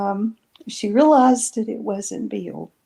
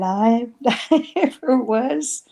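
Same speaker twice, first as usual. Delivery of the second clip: real laugh breaking into the words; higher, laughing through the admission; 4 semitones higher, slower than usual. Real, not acted. Admitting her sister is braver, laughing.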